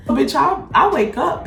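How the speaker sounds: usual speaking voice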